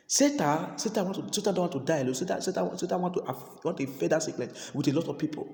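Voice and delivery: ordinary talk, nothing marked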